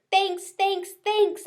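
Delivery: high loud hamster squeak; squeaky thanks. A narrator's reading. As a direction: squeak excitedly. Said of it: "I squeaked".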